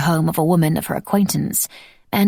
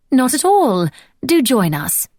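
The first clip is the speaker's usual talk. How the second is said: lightly voiced hostess; 7 semitones higher, 3 dB louder, slower than usual. A narrator's reading. Francesca voiced hosting.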